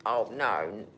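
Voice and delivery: low, put-on groaning voice; mock dismay. Scolding her cat Echo for clawing in a low mock-dismayed voice. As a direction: pretend horror at the claws, voice dropped low.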